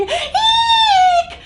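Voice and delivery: high, frightened shriek. A narrator's reading. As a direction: shriek in alarm.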